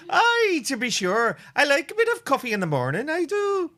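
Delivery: high, lilting mock Irish brogue that slips into Scottish; bad Irish accent. Host asked by co-host to use his lovely accent, bad Irish. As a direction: ham up a terrible Irish accent. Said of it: "feel free to use that really lovely accent".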